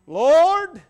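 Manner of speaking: high, loud cry of "Lord"; cry for mercy. Acted. Thief cries out before all his enemies, pleading loudly. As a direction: call out to heaven. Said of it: "there was an open cry for mercy".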